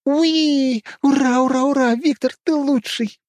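high, loud delighted squeal. A narrator's reading. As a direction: squeal with joy like a bubbly girl. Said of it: "Юлия завизжала от восторга". (восторженный визг)

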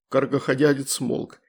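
ordinary delivery, nothing marked

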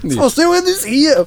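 high, drawn-out put-on voice; slow, exaggerated high-pitched insistence; mock indignant denial. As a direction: protest innocence in a silly squeal. Acted protest of innocence.

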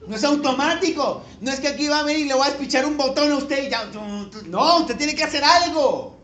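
loud, emphatic preaching, pushed high; fiery preacher call to change; preaching at full force. Acted. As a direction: drive it home to the congregation, full voice. Preaching climax continues.

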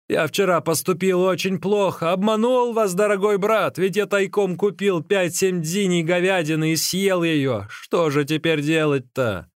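high-pitched, contrite confession; dismayed exclamation. A narrator's reading. Runner admits secretly eating beef, dismayed exclamation. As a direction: confess it in alarm. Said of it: "воскликнул Ликуй".

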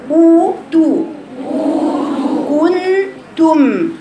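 «أوتو» و«كنتم» بنبرة عالية ممدودة; مد الضم في «أوتو» و«كنتم»; صوت الضمة الممدود. Shown as a demonstration. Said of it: "صوتها مستعرض كده". («كنتم» بطيئة جدًا بضمة بارزة)

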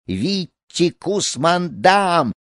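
high, drawn-out cry of "Витти Кусмандам"; calling from behind. A narrator's reading. Fleeing boy hearing the shout behind him, high drawn-out call. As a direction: stretch both foreign words like a distant yell. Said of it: "слыша позади себя".

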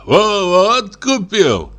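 high, slow, stammered tipsy mirth; slow, high-voiced amusement; through laughter. A narrator's reading. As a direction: stretch it out as if still choking on laughter. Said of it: "выговорил он сквозь смех".

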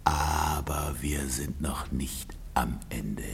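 low, slow whisper to himself; bitter whisper. Acted. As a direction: mutter the rebuke at yourself. Husband alone, vowing revenge.

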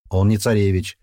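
usual speaking voice

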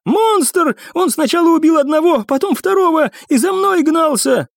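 frantic, high-pitched account; panicked cry. A narrator's reading. Cadet tells rangers of the monster chasing him, panicked. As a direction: pour it out as if still hunted.

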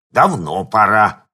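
медленно, громче, старческий голос; ворчливая бабка у подъезда; шамкающая старушка. A narrator's reading. Та же старушка-пенсионерка. (старушка ворчит медленно и громко)

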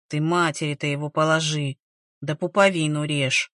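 put-on low, grumbling old village woman. A narrator's reading. Elderly relative scolding the midwife over the newborn, grumbling. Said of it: "заворчала одна из пожилых".

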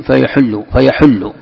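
"فيحل" as fa-yaḥullu; damma on ḥā’. Shown as a demonstration. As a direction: put a u after the ح in "فيحل". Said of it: "بضم الحاء". ("فيحل" بضم الحاء)

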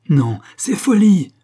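loud, high, drawn-out cry; roaring protest. A narrator's reading. Young man refusing his beloved's oath: roaring protest. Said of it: "rugissait le jeune homme".

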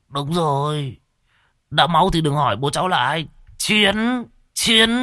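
put-on high, tipsy old man shouting go; rowdy drunk whooping it up; drunk cheering. Drunk Thọt agrees, cheers.

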